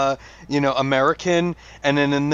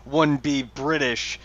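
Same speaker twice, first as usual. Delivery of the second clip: ordinary delivery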